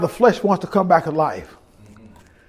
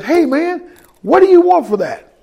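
higher, light imitation of a guy hustling for a deal; eager street buyer; 8 semitones higher than usual. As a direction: toss it off like a curious buyer leaning out of a car. Guy pulls up, asks to buy.

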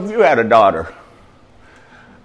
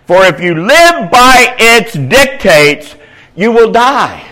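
preaching scripture; 6 semitones higher, 6 dB louder than usual. Acted. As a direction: declaim the verse at full force. Rereads scripture at full force.

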